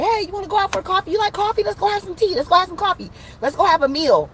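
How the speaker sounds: high-pitched chirpy mock invite; eager invitation. Querent inviting a shy date out for coffee, eager high voice.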